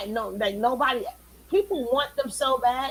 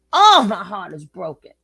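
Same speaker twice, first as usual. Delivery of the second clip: mock heartbreak; 5 semitones lower, 9 dB louder than usual. Mocking a fan's sad comment.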